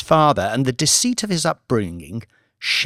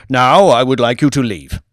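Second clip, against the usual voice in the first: a touch of curt dismissal, louder; imperious old lord; 6 dB louder than usual. Acted. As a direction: send him away, lordly.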